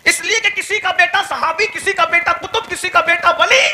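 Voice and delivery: high, loud, laughing sermon cry; fiery preaching. Acted. Preacher rousing the gathering with soaring praise, loud. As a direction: rouse the crowd at full force.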